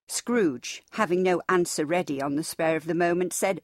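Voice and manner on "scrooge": ordinary delivery, nothing marked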